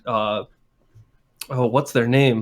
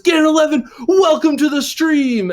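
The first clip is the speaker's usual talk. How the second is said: Jiggy; 13 semitones higher, 4 dB louder than usual. Retold or imitated. Streamer welcoming a viewer beside him, excited announcing shout.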